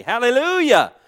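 Hallelujah cried out high and loud. Real, not acted. Pastor praising God at the peak of his sermon, loud cry. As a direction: throw Hallelujah up to the rafters.

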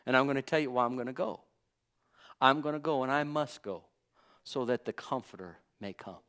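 soft, quiet reassurance; Jesus reassuring his disciples, soft and tender; sweetly. Retold or imitated. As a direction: gently comfort frightened friends. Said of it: "told them so sweetly".

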